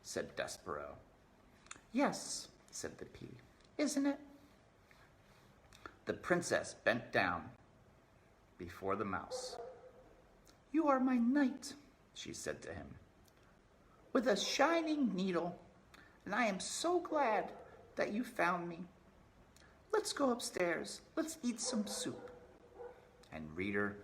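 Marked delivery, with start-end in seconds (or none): 1.9-4.2 princess voice. Acted. Princess answers. 10.7-22.3 high, grateful princess voice; high princess voice to her knight; tender princess. Acted. Princess thanks the mouse.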